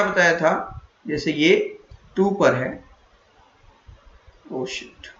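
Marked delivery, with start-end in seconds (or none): 4.5-5.1 muttered curse. Real, not acted. Mistake while teaching.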